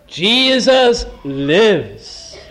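slow, weighty Jesus lives; solemn declaration. Shown as a demonstration. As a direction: lay it down word by word. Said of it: "And I can also say".

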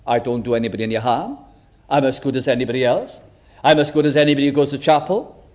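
imitating self-righteous people. Preacher imitating people who excuse themselves, fast and smug. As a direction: rattle off excuses with a hint of smugness. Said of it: "people do talk like that".

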